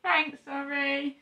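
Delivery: calling out. Real, not acted. Calling thanks outside.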